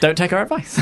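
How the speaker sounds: giggling through a joke disclaimer; laughing. Real, not acted. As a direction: laugh while warning them off.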